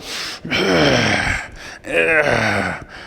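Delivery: long strained breath while pulling; straining groan. Acted. Creator strains as he pulls the rabbit's legs. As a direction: heave with effort.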